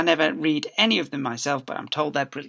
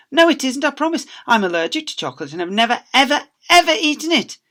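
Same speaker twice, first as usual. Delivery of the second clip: put-on much higher, louder child voice, rushing; pleading girl; 12 semitones higher, 10 dB louder than usual. Girl insisting to Miss Spick, high pleading. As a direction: beg to be believed.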